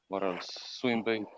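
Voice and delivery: normal talking voice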